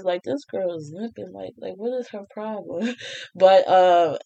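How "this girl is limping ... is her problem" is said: quiet mock gossip, hushed; gossiping classmates. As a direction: murmur it like judging classmates behind her back. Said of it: "people looked at me crazy".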